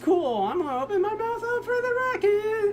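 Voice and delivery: put-on, very high-pitched kid voice; excited little boy. Imagined boy thrilled by the rocket-style bottle, hammed-up excitement. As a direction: climb into a squeaky cartoon squeal.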